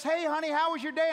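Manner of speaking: high, loud, quick cheery wife voice; wife greeting. Retold or imitated. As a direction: sing it out like a wife greeting at the door. Wife Lisa greets husband.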